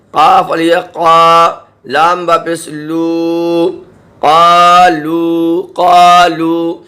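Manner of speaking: قالو with long a and long oo, very slow; slow madd recitation; stretched madd. Shown as a demonstration. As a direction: drag every vowel of قالو. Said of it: "اس لیے ہم کھینچ رہے ہیں".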